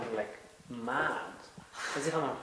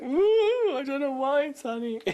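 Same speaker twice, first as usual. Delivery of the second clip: much higher, louder, laughing overreaction; hammed-up weepy squeal over nothing; mock teary gush; 13 semitones higher, 7 dB louder, slower than usual. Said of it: "I'll be like".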